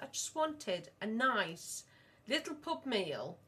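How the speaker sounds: slow, dragged-out grumble; drawn-out complaint. Retold or imitated. Mum retelling her complaint to her husband, slow and dragged out. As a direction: stretch each word like a sulky wish.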